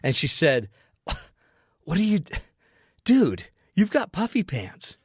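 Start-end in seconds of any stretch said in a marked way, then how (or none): 1.8-5.0 stammer, then draw it out in dismay; mock-horrified wife, pitched up; acted disgusted disbelief. Wife's disgusted reaction, acted.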